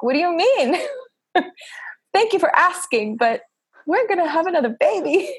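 high, laughing and joyful; excited laughing reply. Retold or imitated. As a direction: bubble over with delight. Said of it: "I'm like".

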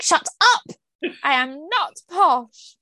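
high mock-outraged burst, then drawn-out protest; retold indignant retort. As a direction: play up the affronted denial, stretching the last words. Retold retort to teasing friend.

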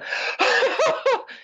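a quick high giggle; high laugh. Real, not acted. Guest laughing high after describing a belly cry. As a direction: let a high laugh slip out.

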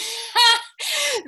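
sudden high self-amused laugh; high burst of laughter. Real, not acted. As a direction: laugh out at yourself. Laughs at herself.

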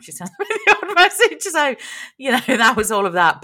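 high, loud laughing speech; laughing through words. Real, not acted. Passenger admitting the awkward friendship, laughing through it. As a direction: let the giggle carry the words, settling gradually. Laughs while ending embarrassing story.

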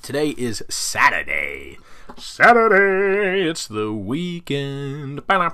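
slow, drawn-out radio host delivery with a high swoop on Saturday. Pretend. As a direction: announce it like an on-air DJ. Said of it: "I just felt like a, a radio host".

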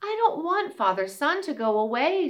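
squirrel voice. Acted. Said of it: "said the squirrel".

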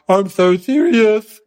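slow, deadpan stuck-up voice; slowly mocking the aunt's stern rich-lady manner; mock serious rich aunt. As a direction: drag it out, dead serious and posh. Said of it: "She always looks so serious".

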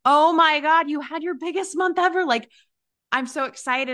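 excited cheer. Retold or imitated. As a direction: gush over the client's big month.

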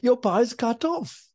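high, taunting cackle of a spirit; mocking demonic voice. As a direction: jeer at the preacher's lost power. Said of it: "this woman began to speak words and she said".

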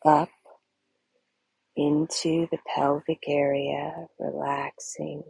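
very slow, low, hushed meditation voice; slow, soothing body-scan delivery; guided meditation. Acted. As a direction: ease them into deep relaxation with long pauses.

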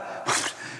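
a short breathless chuckle; soft breathy laugh. Real, not acted. Patient recalling grim thought, soft breathy laugh. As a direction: huff out a quiet laugh.

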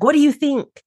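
high-pitched imitation of an expectant asker; acted eager question. Presenter asking for a verdict, in a high mock-eager voice. Client put on the spot.

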